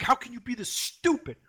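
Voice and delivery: mild mock yell. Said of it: "He was yelling at him".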